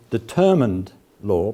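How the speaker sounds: "determined" slow and pressed, each syllable weighted; stress on determined. Shown as a demonstration. Lecturer marking Derrida's emphasis, slow stress on "determined". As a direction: lean hard on "determined law". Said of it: "and this is his emphasis".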